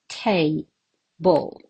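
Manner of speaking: "Table" split as TA, BLE; syllable by syllable. Shown as a demonstration. Teacher counting syllables for learners, word broken in two. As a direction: pause between the syllables of Table. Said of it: "how many syllables are there".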